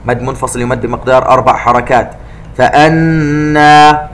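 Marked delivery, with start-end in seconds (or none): 2.6-4.1 فأنا slow, the doubled nun nasalised; ghunna of two counts. Shown as a demonstration. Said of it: "النون المشددة نغنها". (غنة بمقدار حركتين في فأنا)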